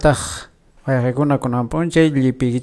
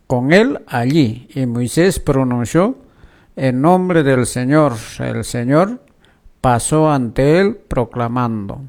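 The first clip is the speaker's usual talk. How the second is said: louder, measured lectern reading; scripture reading; 4 dB louder than usual. Acted. Host reading Exodus to radio listeners, formal lector reading. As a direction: read the lesson to the congregation. Reads Exodus lesson aloud.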